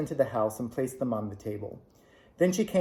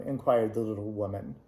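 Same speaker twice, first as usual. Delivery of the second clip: usual speaking voice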